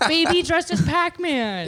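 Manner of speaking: high cooing delight. Real, not acted. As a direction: coo at the cute picture. Sees cute baby picture.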